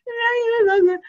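the shaman finally pouring it out in tears; crying relief; tearful confession. Retold or imitated. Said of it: "He's like".

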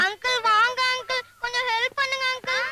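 tearful plea; crying. Acted. Begging uncle to come and help, crying. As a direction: beg for help between sobs. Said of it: "உட்கார்ந்து அழுதுட்டு இருக்கு".

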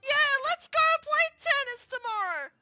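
high, loud eager squeal; eager cheer. Acted. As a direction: cheer the plan at top pitch. Eager about tennis plan.

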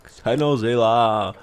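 low, slow stretched-out name; lingering low repeat of a name; drawn-out echo. Acted. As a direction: draw the name out slowly and low.

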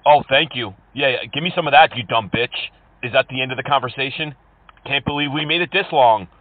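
usual speaking voice

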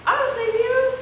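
high, bright heroic call; chiming hero. Acted. Unicorn answering his own game as the hero, chiming. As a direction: sing out the rescue like a little hero. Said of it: "he'd chime back".

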